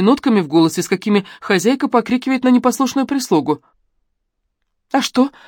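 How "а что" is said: дерзко, с вызовом, до дрожи; бросить вызов, дрожа от злости; вызывающе. A narrator's reading. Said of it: "вызывающим до дрожи голосом". (вызывающим до дрожи голосом)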